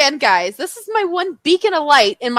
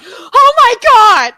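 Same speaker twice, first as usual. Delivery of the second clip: slow, higher, louder awed cry; starstruck gasp; 9 semitones higher, 5 dB louder, slower than usual. Retold or imitated. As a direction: gasp it, overwhelmed by the star. Friend sees her idol.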